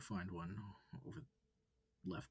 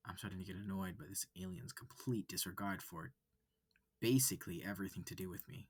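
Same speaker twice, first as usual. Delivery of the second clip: ordinary delivery, nothing marked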